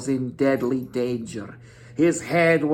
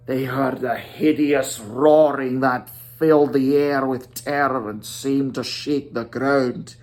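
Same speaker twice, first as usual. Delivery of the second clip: ordinary delivery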